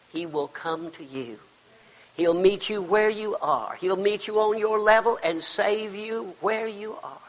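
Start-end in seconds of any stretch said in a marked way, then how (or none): none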